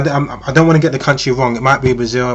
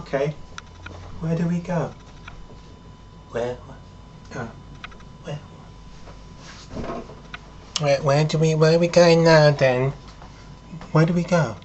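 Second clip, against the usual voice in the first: higher, dopey mock voice of a smitten man; hesitant, pitched-up, entranced questioning; besotted follower; 4 semitones higher, 5 dB quieter than usual. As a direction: play someone trailing helplessly after a temptress. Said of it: "you're following her, drooling at the mouth".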